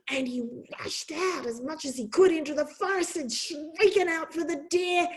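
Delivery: ordinary delivery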